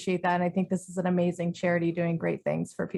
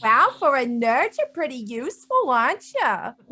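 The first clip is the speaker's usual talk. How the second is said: higher-pitched teasing character voice; 4 semitones higher, 3 dB louder than usual. Acted. Character ribbing the nerd who opened the door, teasing. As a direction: tease the nerd with backhanded praise, pitched up. Player teases Mort in character.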